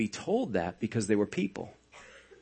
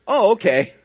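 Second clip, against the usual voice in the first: mock relief; 6 semitones higher, 5 dB louder than usual. Reacting as the alarmed women.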